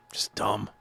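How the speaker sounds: whispered aside. Real, not acted. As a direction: breathe it out under your breath.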